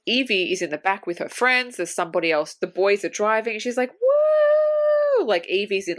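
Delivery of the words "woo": long high-pitched whoop; excited whoop. Retold or imitated. Friend imitating Evie in the back of the Jeep, excited whoop. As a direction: imitate a yelling joyrider's sustained woo. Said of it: "like, yelling".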